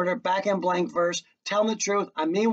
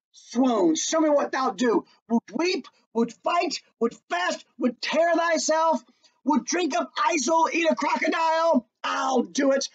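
mocking rant; 8 semitones higher than usual. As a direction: shout him down, escalating. Hamlet ranting at Laertes.

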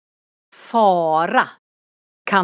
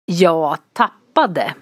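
ordinary delivery

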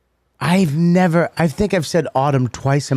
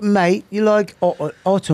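put-on higher-pitched, loose British accent; mock British accent; 4 semitones higher than usual. Comic imitating the English saying autumn, mock British accent. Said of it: "If you're English".

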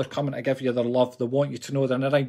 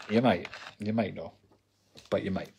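teasing low voice; 8 semitones lower, 8 dB quieter than usual. Acted. Teasing about nostril close-up.

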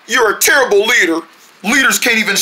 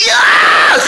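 cheer; 13 semitones higher, 5 dB louder than usual. Acted. Triumphant cheer after bang.

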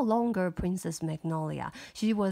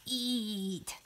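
creepy volcano voice; 6 dB quieter than usual. Acted. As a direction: growl it like a hidden monster. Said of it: "很可怕的声音".

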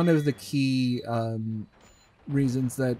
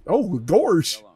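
high, louder cartoon goof voice; Goofy voice again; 6 semitones higher, 7 dB louder than usual. Redoing the line for cohost in a Goofy voice. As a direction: honk it like the cartoon dog. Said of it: "I read that in Goofy's voice".

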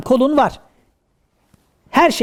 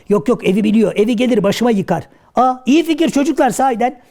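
ordinary talk, nothing marked

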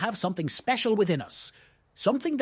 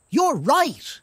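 bright cry, higher and louder; happy exclamation; 7 semitones higher, 5 dB louder than usual. Acted. Ziggy realises the owl is right, happy exclaim. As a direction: burst out with sudden understanding. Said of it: "Ziggy exclaimed".

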